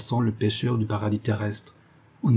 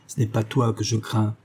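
usual speaking voice

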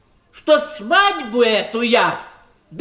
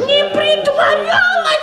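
much higher, astonished echo of her words; shocked disbelief. Acted. Husband echoing bride's confession in high shocked disbelief. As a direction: throw her word back at her in astonishment.

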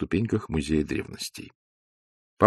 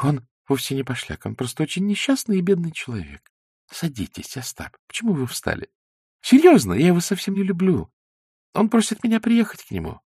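put-on higher, girlish playful voice; lightly voiced young woman; 9 semitones higher than usual. A narrator's reading. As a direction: Zosya teasing Ostap in a girlish voice. Zosya's voice.

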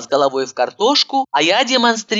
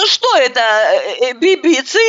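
higher, tearful stammer; hunted, frightened question; 5 semitones higher, 3 dB louder than usual. A narrator's reading. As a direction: stumble over the strange word in fright. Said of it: "затравленно глядя, спросила она".

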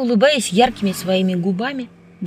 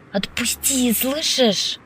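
understated angry hiss, higher pitched; 4 semitones higher, much slower than usual. A narrator's reading. Woman to the man holding the poet, angry hiss. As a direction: hiss it with contained spite. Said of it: "злобно прошипела она".

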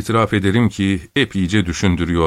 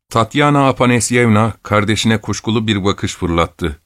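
ordinary delivery

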